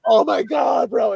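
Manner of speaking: high, loud amazed outburst; amazed shout. Retold or imitated. Narrator shouting his amazement at the band singer, amazed. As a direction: blurt it out, stunned.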